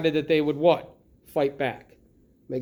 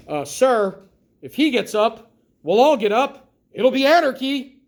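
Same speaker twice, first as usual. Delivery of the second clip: lightly voiced smart-aleck student; a hint of a cocky teen mouthing off; cocky kid; 4 semitones higher, 5 dB louder than usual. Teacher quoting the cocky detention kid, lightly mocking. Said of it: "the cocky kid goes".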